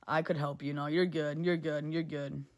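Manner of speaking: normal talking voice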